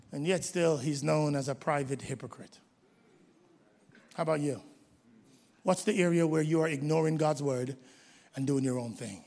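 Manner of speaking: sudden soft drop, low and close; hushed. Acted. Preacher confiding to the congregation, hushed.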